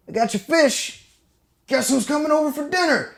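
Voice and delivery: high mocking taunt, imitated; taunting dad. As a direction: gloat over the kid's fish in a high voice. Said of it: "and then he goes".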